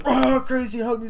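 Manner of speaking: high, loud mock panic; hammed-up plea; panicked cry for help. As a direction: yell for help in terror. Tied-up boyfriend cries for help.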